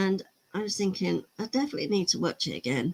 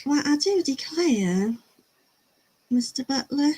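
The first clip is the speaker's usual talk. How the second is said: higher, hammed-up Southern belle accent; mock Southern belle accent, pitched up; 6 semitones higher than usual. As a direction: play a coquettish Southern belle, drawing out the vowels. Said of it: "all I do is quote Scarlett O'Hara".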